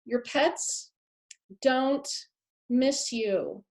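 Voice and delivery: slow, halting build with pauses; a weighty reveal, each word set apart; dramatic reveal. Real, not acted. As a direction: drop the bombshell word by word. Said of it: "the other bombshell".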